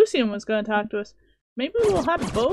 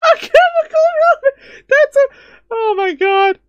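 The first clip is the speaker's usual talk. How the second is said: higher, louder giddy laughing; laughing delight; 13 semitones higher, 7 dB louder than usual. Real, not acted. Player cracking up at the vampire's pun, laughing loudly. Reacting to joke.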